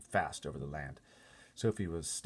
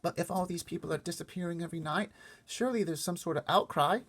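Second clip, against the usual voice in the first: put-on higher, small girl voice; little girl Sophie; 12 semitones higher, 5 dB louder than usual. Sophie asking the giant about the other giants, small high girl voice. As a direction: speak up like a worried little girl.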